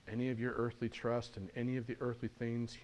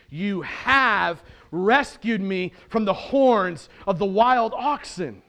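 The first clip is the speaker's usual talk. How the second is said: emphatic, louder declaration of rescue; victorious praise; 7 semitones higher, 13 dB louder, slower than usual. Acted. Risen Jesus praising God for rescue, victorious. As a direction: proclaim the rescue triumphantly. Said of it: "This is the victorious shout of praise".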